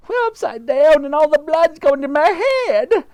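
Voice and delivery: wife screaming blood rushes to her head. Acted. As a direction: wail in panic. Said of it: "screamed Miss Twit".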